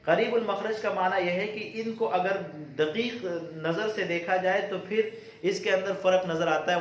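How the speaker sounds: ordinary delivery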